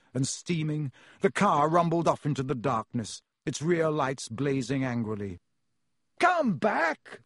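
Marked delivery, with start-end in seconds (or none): 6.2-7.2 very high call after someone; yell. A narrator's reading. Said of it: "Ron yelled after it".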